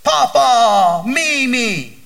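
put-on high, loud child calling out; gleeful kids bursting in the door; excited grandkids. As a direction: call like an eager little grandkid. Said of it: "come bounding into the house saying".

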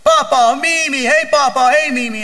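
put-on high, loud child calling out; gleeful kids bursting in the door; excited grandkids. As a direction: call like an eager little grandkid. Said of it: "come bounding into the house saying".

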